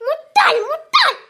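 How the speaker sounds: upset name-calling, each insult thrown separately; hurt, heated telling-off; angry scolding. Acted. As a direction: scold them in exasperation. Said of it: "திட்டுனா திட்டுக்க".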